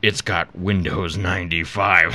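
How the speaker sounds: slow, low devil breaking into laughter; Satan voice. Acted. Satan revealing the catch, laughing evilly. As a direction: gloat slowly, then cackle. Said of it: "Good Satan voice".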